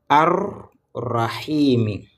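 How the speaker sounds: Ar-Rahimi drawn out very slowly with the doubled r held; slow recitation. Shown as a demonstration. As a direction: chant Ar-Rahimi slowly, syllable by syllable. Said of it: "regardez bien, encore".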